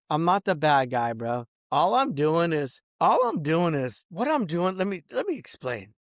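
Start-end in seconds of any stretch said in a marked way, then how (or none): none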